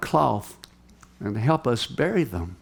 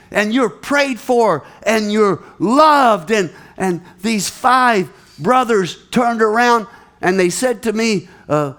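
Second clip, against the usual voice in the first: preaching at full force; 6 semitones higher, 6 dB louder than usual. Acted. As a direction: build the crowd with every phrase. Preacher building to a climax.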